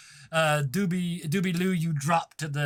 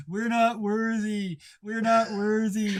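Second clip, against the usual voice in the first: high, loud mock-adoring chant; drawn-out wail of crowd worship; worshipping crowd; 8 semitones higher, 6 dB louder than usual. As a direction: chant it high like fawning fans. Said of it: "They all start going".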